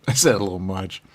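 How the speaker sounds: ordinary delivery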